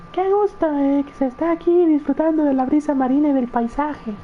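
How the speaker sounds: high falsetto woman's voice; man doing a woman's voice. Acted. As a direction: push the pitch way up, play the heroine. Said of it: "Yo no sé hacer voz de mujer".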